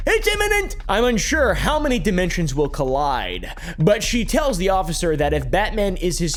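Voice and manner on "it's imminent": very high, sharp exclaimed quote; loud sharp voice. Retold or imitated. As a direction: squeal it out, imitating her excitement. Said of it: "She says".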